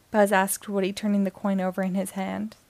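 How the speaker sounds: usual speaking voice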